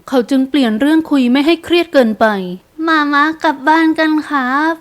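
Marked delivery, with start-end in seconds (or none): 2.6-4.7 put-on high, slow child's voice; four-year-old's pitched-up, drawn-out plea; little boy. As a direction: coax mama like a small son. Small boy urges mother home.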